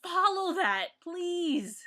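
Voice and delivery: heated, pleading follow that; worked-up plea. Real, not acted. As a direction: demand it, fed up. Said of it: "I'm getting so worked up".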